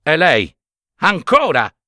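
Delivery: a touch of a fed-up priest; angry outburst. A narrator's reading. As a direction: blurt it out in annoyance. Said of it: "scrollandosi tutto rabbiosamente".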